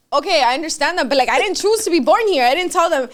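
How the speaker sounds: exasperated pleading. Retold or imitated. Retells her exasperated reply.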